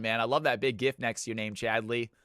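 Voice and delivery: normal talking voice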